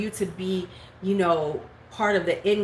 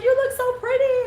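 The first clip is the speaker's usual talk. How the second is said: gushing compliment; 5 dB louder than usual. Retold or imitated. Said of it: "she was like".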